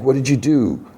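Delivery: high-pitched retold shock, no real panting; a touch of horrified disbelief, retold; mock alarm. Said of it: "He's, like, panting".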